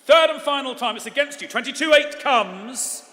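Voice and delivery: loud, ringing call for final bids; auctioneer. Acted. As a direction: call the lot like a live auctioneer. Auctioneer closing the bidding.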